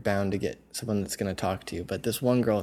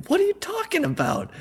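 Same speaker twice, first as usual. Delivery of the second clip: very high-pitched mock disbelief; retold reply, voice shooting up in bafflement; baffled protest. Retelling his baffled reply to her.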